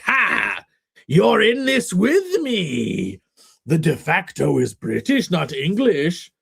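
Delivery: hammed-up triumphant declaration; mock British domineering. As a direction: crow it, drawing the words out theatrically. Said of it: "It's like, "I am not English, I am British".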